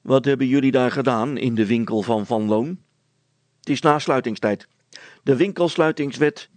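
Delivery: loud officious challenge from the village constable; calling out. A narrator's reading. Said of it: "Hij sprong meteen van zijn fiets en riep".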